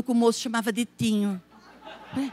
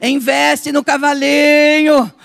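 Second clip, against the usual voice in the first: slow, loud, driving each word home; forceful preaching; 5 dB louder, slower than usual. Acted. Preaching build-up. (pregação forte e lenta)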